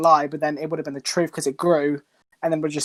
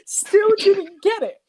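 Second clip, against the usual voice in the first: high, drawn-out exasperated wail; real amused exasperation, almost wailing; exasperated disbelief; 12 semitones higher, 3 dB louder, slower than usual. Real, not acted. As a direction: stretch it slowly, pitched high in disbelief. Exasperated, laughing at person not understanding.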